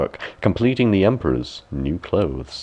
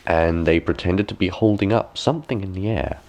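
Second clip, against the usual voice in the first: ordinary delivery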